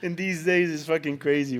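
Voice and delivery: usual speaking voice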